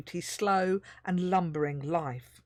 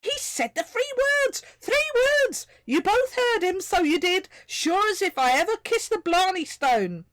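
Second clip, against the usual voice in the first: much higher, louder, Irish-lilted rapture; ecstatic Irish tiny voice; 13 semitones higher, 6 dB louder than usual. A narrator's reading. Tiny Irish voice hails the troll's three words: ecstatic. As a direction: gush with Irish delight. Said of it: "the ecstatic first verse".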